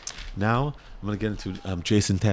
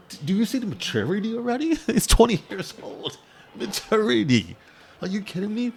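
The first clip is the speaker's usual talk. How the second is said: high, fast, incredulous; can't believe how mature he sounds; amazed disbelief; 10 semitones higher than usual. Real, not acted. Fan reading a rookie's quote, amazed disbelief.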